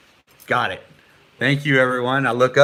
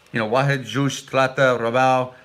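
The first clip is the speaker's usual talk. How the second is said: ordinary delivery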